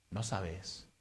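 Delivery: ordinary talk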